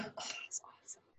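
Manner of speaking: hushed whispered reply. Real, not acted. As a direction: whisper it so as not to interrupt. Whispered aside during mantra.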